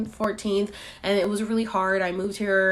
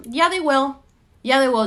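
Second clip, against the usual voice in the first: louder, higher, sure of it; confident insistence; 6 semitones higher, 6 dB louder than usual. Retold or imitated. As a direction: insist they'll give it to you. Insisting to the realtor.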